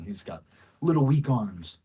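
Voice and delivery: normal talking voice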